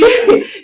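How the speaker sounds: short high burst of laughter; a quick laugh that pops out; short bright laugh. Real, not acted. Laughs at her own slip.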